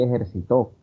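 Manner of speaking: "ejercitó" stressed on the final TÓ; "ejercitó" with the punch at the very end; stress on last syllable. Retold or imitated. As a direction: say "ejercitó" as e-jer-ci-TÓ. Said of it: "Algunos escuché por ahí".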